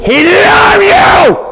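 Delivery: roaring hammed-up preacher, high and slow. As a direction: shout the love like pure rage from the pulpit. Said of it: "have a voice".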